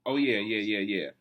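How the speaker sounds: ordinary delivery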